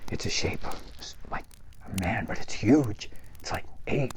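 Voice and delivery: low spooky whisper for the build-up; hushed storytelling. A narrator's reading. Storyteller whispering the scary part to campers. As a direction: draw them in with a hush.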